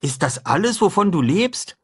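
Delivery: put-on high, lively boyish voice; a cheerful boy proposing breakfast; boy talking brightly to his friend; young boy Huck. A narrator's reading. Huck's voice.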